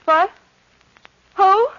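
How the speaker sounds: loud, high confused cry; startled waking. Acted. Woman waking from a nightmare, startled and confused. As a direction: jolt awake and gasp it out.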